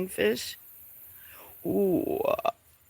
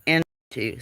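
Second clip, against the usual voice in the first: ordinary talk, nothing marked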